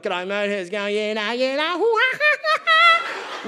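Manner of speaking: exaggerated broad Australian ocker talk ending in a high, slow laugh; broad Australian accent. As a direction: play up the Aussie bloke, then cackle high.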